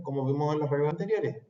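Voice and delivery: ordinary talk, nothing marked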